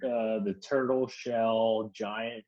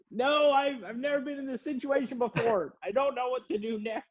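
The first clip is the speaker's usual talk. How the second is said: very high, meek imitation of himself; helpless high voice; 14 semitones higher than usual. Narrator admitting he is lost to the lady, very high helpless mock voice. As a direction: whine it small and lost. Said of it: "I was like".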